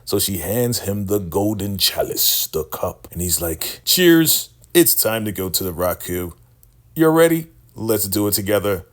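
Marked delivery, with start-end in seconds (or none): 3.8-8.9 smug cult leader toast. Retold or imitated. Cult leader toasting the woman before drinking, loud swagger. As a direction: raise a toast like a grand finale.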